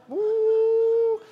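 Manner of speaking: long, held, very high "woo"; high-pitched whoop. Pretend. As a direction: let out a drawn-out falsetto whoop. Mimics reaction to mailbox crash.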